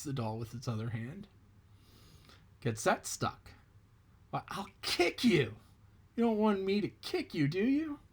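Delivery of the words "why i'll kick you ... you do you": high rabbit voice, threatening; taunting rabbit. Acted. Rabbit taunts the tar doll, high and mocking. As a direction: dare the doll to make you kick.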